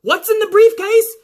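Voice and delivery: high, loud clueless question; clueless referee. Retold or imitated. Referee asks about the briefcase, high and clueless. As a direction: ask like you've never heard of it. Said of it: "he's like".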